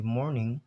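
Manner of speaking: "morning" with the r clearly sounded; "morning", r curled and audible; American R pronounced. Shown as a demonstration. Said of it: "in American".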